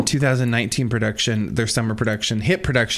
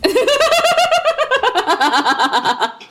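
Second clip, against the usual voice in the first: loud laugh burst; 5 dB louder than usual. Real, not acted. Big laugh after mom story.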